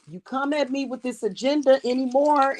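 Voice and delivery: ordinary talk, nothing marked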